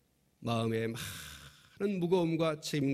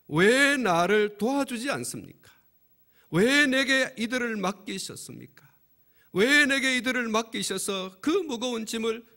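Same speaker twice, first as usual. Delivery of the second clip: higher, louder pleading protest to God; pouring out complaints; 6 semitones higher, 4 dB louder than usual. Acted. Moses, overwhelmed by the people, pouring out raised complaints to God. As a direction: hurl each why at heaven. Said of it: "이러면서 마구 쏟아냈어요".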